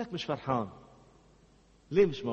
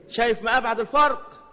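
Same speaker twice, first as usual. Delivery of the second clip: raised emphatic challenge; 6 semitones higher, 6 dB louder than usual. Real, not acted. Preacher challenges listener loudly.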